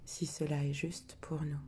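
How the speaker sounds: hushed, prayer-like recitation of the intention; solemn invocation. Acted. Healer opening the sacred circle for the group, hushed and solemn.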